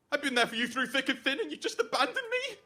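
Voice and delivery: indignant protest that rises sharply; hurt indignation. Acted. Best man to the friend who abandoned him, wounded and indignant. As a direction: voice climbing to a high, wounded question. Acted hurt complaint to Mike.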